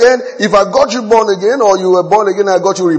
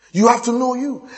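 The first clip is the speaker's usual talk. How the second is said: normal talking voice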